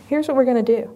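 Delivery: ordinary talk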